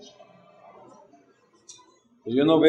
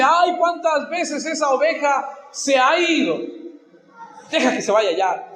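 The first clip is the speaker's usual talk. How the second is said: high, fed-up mock complaint; exasperación fingida; 9 semitones higher than usual. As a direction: act out losing patience, pitched well up. Pastor imaginado harto de la oveja. (queja exasperada, con voz aguda)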